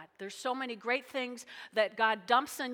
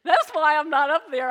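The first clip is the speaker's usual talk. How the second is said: much louder self-mocking quip; laughing loud aside; 4 semitones higher, 13 dB louder than usual. Real, not acted. Pastor joking about her singing to the congregation, loud laughing aside. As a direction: blurt it out with a laugh at yourself. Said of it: "please don't judge me".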